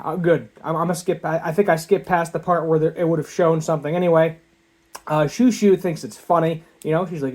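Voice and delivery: ordinary talk, nothing marked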